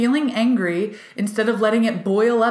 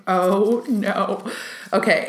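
ordinary talk, nothing marked

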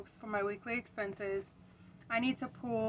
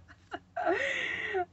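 breathless laugh; 12 semitones higher than usual. Real, not acted. Breathless laugh after admitting it.

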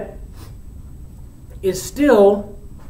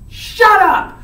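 higher, louder yell of shut up; friar yelling; 8 semitones higher, 4 dB louder than usual. Retold or imitated. The friar cuts off whining Romeo, yelling. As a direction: escalate into a real yell. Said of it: "And the friar goes".

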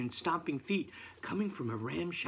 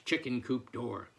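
ordinary delivery

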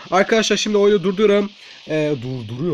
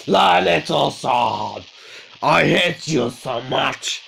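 very slow, put-on voice; drawn-out cursing; 2 semitones lower, much slower than usual. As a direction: stretch out the curse after a defeat. Drawn-out cursing after losing.

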